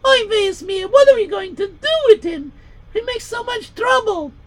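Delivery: high, put-on Yiddish mother voice; wailing old-world mother; stepmother voice. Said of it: "And she would say".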